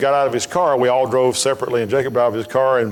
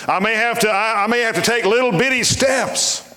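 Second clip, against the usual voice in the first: high-pitched pulpit declaration; impassioned preacher's resolve; emphatic preaching; 7 semitones higher than usual. Real, not acted. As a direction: push it out high, stretching toward the end. Preacher declares resolve to keep going.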